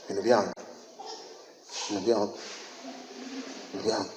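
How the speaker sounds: soft, low Bino byange fading each time; possessive mutter. Acted. Pastor mimics a miser guarding his money, soft and fading. Hoarding owner repeated, fading.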